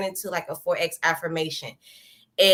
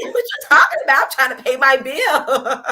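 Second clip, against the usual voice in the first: laughing disbelief; 4 semitones higher, 6 dB louder than usual. Retold or imitated. Retold disbelief at manager's prayer offer.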